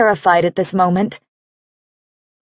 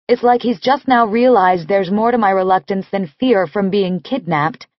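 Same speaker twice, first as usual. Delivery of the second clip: ordinary delivery, nothing marked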